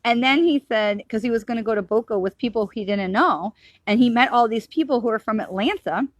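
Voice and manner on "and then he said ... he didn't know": usual speaking voice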